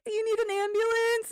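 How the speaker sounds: high-pitched dazed question, imitating oneself in shock; retold distraught. Crash victim on the phone with police, imitated as high-pitched distraught. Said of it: "That's how distraught I was".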